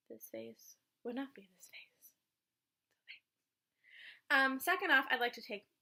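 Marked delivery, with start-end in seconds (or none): 0.1-2.1 whisper. Real, not acted. Aside about makeup.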